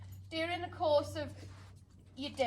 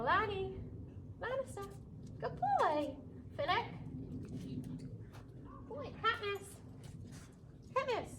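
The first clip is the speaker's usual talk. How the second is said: higher, softer gentle praise; 7 semitones higher, 9 dB quieter than usual. Shown as a demonstration. Trainer praising dogs who sat nicely, soft and tender.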